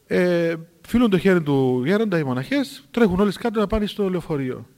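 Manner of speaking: ordinary delivery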